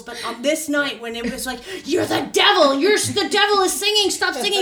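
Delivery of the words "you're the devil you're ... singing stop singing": yelling, high and loud; hammed-up angry yell at the singer; retold shouting. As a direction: accuse them like a furious friend. Friends fighting in car.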